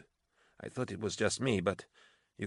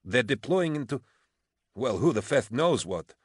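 heated, pitched-up complaint; exasperated protest; 5 semitones higher than usual. A narrator's reading. Officer protesting for her worn-out regiment, exasperated. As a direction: argue it in frustration.

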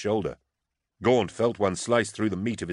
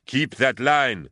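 slow, higher drawn-out bawl; bawled order; 5 semitones higher, 4 dB louder, slower than usual. A narrator's reading. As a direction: bawl it at the troops. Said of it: "Baskerville bawled at the Urdeshi".

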